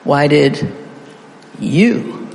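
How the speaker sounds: "you" set apart after a pause, rising; "Why did" level, then "you" swooping up; stress on YOU. Shown as a demonstration. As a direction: hold back, then lean on "you". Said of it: "You're going to emphasize".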